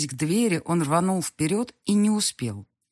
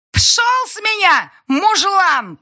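very high, loud, slow shriek; 10 dB louder, much slower than usual. A narrator's reading. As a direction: shriek at him furiously. Girl fights pinned down.

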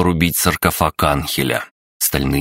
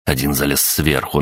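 plain reading voice

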